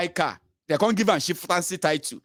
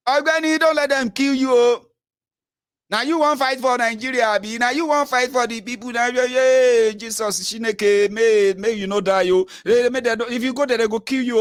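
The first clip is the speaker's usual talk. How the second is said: loud pleading; 6 semitones higher, 4 dB louder than usual. Retold or imitated. Pleading friend not to fight.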